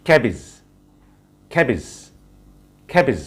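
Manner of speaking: ক্যাবেজ with an aspirated kh, "KHA-bij"; ক্যাবেজ drilled with a breathy kh and a short ij; teacher modelling cabbage with an aspirated start; right pronunciation. Shown as a demonstration. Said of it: "এটার right প্রনাউন্সিয়েশনটা হচ্ছে".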